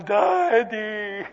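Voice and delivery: loud, high mock cry for Daddy; comic little-girl howl; wailing child. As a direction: imitate a stuck kid's plaintive wail. Tangled daughter calls for father.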